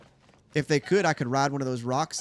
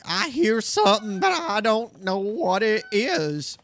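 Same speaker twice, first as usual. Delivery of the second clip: high, loud, drawn-out dopey monster; slow, goofy high-pitched creature impression; dumb moblin voice; 8 semitones higher, 5 dB louder, slower than usual. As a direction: play a dim-witted moblin, loud and pitched up. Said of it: "That's what he sounds like".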